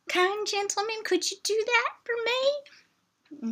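put-on high, slow, quaint old-fashioned caller; hammed-up genteel request to the operator; mock old lady. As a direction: play a sweet little old lady asking politely.

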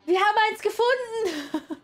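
sudden high-pitched burst of triumph; excited exclamation. Real, not acted. Player finally spots one of the things searched for, excited. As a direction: cry out high in delight at the find.

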